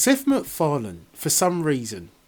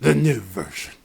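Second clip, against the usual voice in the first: mock the clip's low growl; gruff echo; 4 semitones lower than usual. Echoing the trailer's gruff line, low and gruff. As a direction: lower gruff repeat of the line.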